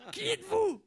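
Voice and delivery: ordinary talk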